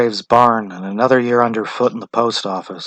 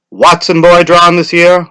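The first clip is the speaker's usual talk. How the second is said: louder, higher formal question; official; 8 semitones higher, 10 dB louder than usual. A narrator's reading. Official questions the wife, louder and formal. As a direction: ask it by the book.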